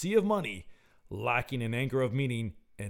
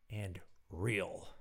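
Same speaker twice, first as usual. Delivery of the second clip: usual speaking voice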